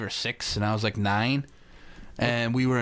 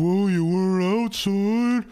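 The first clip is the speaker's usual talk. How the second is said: loud, high, slow dismissive stepdad; mock stepdad; 8 semitones higher, 5 dB louder, slower than usual. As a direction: brush the kid off, hammed up. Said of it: "he'd be like".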